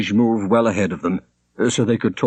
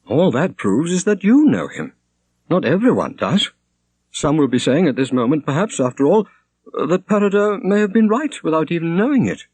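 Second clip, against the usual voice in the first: a hint of a troubled young woman, higher; lightly voiced young woman; 9 semitones higher than usual. A narrator's reading. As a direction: Shonerd pleading with a touch of sadness. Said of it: "said Shonerd".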